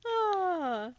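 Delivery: gasping swoon, high and breathy; a lovestruck gasp mid-sentence. Real, not acted. Swooning over favorite scene.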